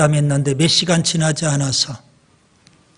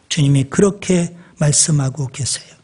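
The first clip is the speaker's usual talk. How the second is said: ordinary delivery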